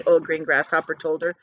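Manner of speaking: usual speaking voice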